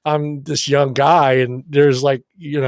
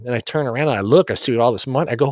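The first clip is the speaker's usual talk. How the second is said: ordinary delivery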